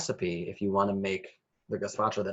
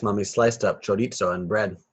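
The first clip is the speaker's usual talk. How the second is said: ordinary delivery, nothing marked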